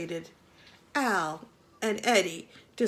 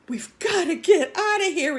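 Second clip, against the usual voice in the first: croaked; 7 semitones higher, 7 dB louder than usual. Acted. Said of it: "he croaked".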